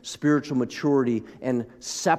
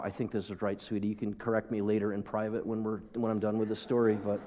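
dropped voice, aside to his wife; soft aside to wife; 3 semitones lower, 7 dB quieter than usual. Real, not acted. Pastor quietly teasing his wife in the front row, soft aside. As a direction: murmur it off to the side, just for her.

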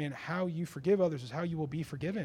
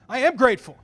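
higher, loud protest of a wounded wife; indignant protest; 4 semitones higher, 11 dB louder than usual. Retold or imitated. Wife answering a rebuke for ingratitude, indignant. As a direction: protest it loudly, lifting the pitch.

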